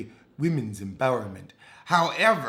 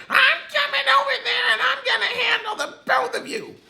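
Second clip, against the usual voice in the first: high-pitched laughing shout; Misha going off; 6 dB louder, faster than usual. Retold or imitated. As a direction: yell the threat much higher and louder, cackling. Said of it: "Misha went off".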